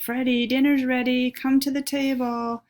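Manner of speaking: called out high and loud, like up the stairs; calling to dinner. Acted. Mom calling her son down to dinner, high and loud. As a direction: mom calls Freddy down to eat.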